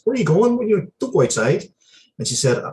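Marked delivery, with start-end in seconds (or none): 0.0-1.6 pitched way up, half-teasing question; high mock quote. To someone during the covid outbreak, a high teasing question. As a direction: squeak the question up high like a fussy parent. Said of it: "I said".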